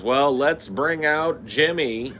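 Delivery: high, slow host announcing; slow, high showman intro. Retold or imitated. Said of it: "And then he goes".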